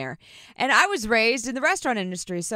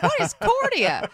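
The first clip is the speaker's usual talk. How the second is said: confused dog; 10 semitones higher than usual. Retold or imitated. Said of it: "looking like a dog with his head cocked sideways".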